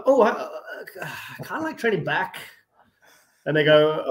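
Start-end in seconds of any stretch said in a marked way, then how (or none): 0.1-2.4 higher, halting, with long teasing pauses; dramatic pauses. Retold or imitated. Imitating Ronnie teasing the seminar crowd, halting dramatic pauses. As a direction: hold back each word to build suspense. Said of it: "the way he does the dramatic pauses".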